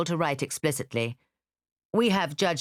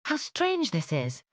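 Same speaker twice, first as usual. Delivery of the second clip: wondering; 9 semitones higher than usual. Acted. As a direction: let the strangeness sink in. Elizabeth marvelling.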